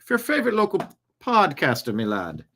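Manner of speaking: hammed-up Irish brogue, pitch jumping about; put-on Irish accent. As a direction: play a cajoling Irishman. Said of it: "I don't know why he's Irish".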